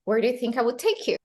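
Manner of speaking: rapid linked line, "take you" as "tay-kyou"; connected words. Shown as a demonstration. Teacher models the whole question connected, fast linking. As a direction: run it all together at speed. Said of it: "connect the words".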